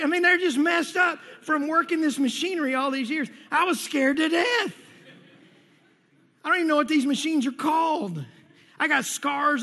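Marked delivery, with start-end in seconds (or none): none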